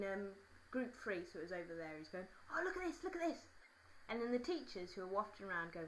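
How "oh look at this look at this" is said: high put-on boy's excitement; eager, high mimicked voice; excited schoolboy. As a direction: imitate a boy urging his mate to look. Acts boy calling his mate.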